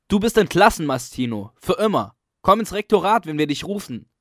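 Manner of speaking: loud, high mock dismissal; triumphant shout. A narrator's reading. Coco firing the caretaker, loud mocking shout. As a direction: fire him, shouting. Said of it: "Dann fügt er hinzu".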